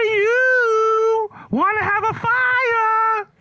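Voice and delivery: very high, loud, slow wailing caveman cry; Neanderthal voice. Pretend. Comedian imitating a Neanderthal asking about a fire, high slow wail. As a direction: screech it like a shrill caveman. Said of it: "A Neanderthal actually would say".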